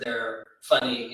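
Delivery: ordinary talk, nothing marked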